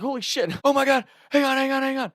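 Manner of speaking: high, loud frantic begging; panicked pleading. Retold or imitated. Facing grandpa's gun, high frantic begging. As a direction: beg for time at gunpoint.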